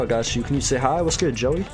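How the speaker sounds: ordinary talk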